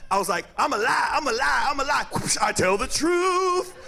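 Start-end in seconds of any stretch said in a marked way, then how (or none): 0.6-2.0 higher, singsong repeated boast; lightly acted kid chanting; mock young liar. Boy bragging before mom's belt, mock singsong. 2.1-3.7 high, slow squeal after a whack; mock yelp. Boy cured of lying by mom's belt, high wail.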